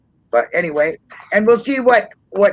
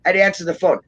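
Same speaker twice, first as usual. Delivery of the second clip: ordinary talk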